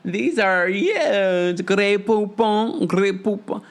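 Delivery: slow, drawn-out posh gush; mock gushing Lisa. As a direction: savour the brand name like luxury. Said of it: "Lisa can't help herself".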